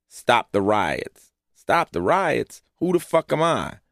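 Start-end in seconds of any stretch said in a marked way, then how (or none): 1.5-2.6 higher, drawn-out disbelieving repeat; stretched, high-pitched disbelief; incredulous echo. Retold or imitated. Echoes plea back in disbelief. 2.8-3.8 high-pitched, baffled comeback; scoffing at being asked; incredulous retort. Retold or imitated. Scoffs at the newscaster's request.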